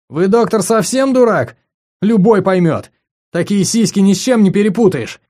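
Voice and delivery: loud, high, scornful; crude exasperated outburst. Acted. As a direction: bark it like he's stupid. Simon insults the doctor.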